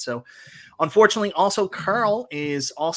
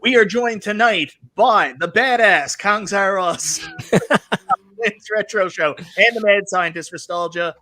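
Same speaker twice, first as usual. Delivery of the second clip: ringmaster; 3 semitones higher, 6 dB louder than usual. Acted. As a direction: boom it out like a circus ringmaster. Show host opening the stream.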